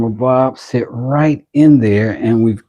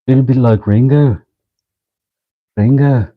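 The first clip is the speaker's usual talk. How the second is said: put-on Beatle accent; 4 semitones higher, 4 dB louder than usual. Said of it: "Why do I sound like a Beatle?".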